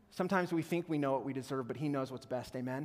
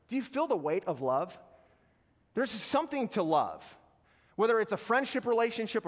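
ordinary talk

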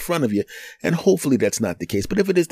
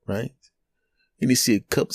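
ordinary talk, nothing marked

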